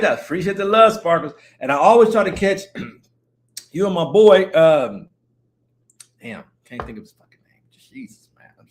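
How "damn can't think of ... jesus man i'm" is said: unvoiced whispered Jesus; exasperated whisper at forgetting a name; whispered exasperation. Real, not acted. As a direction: breathe it out in frustration. Frustrated he forgot a name.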